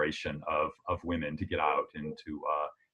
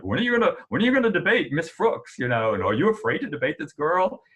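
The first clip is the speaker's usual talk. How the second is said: high, louder imitation of hounding reporters; pestering reporters; 9 semitones higher, 5 dB louder than usual. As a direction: mimic the press badgering a politician with questions. Voicing reporters pestering the assemblyman.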